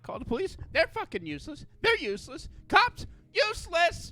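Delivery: imitated meltdown. Woman ranting to a stranger about useless police, meltdown. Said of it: "she's having a fucking meltdown about this".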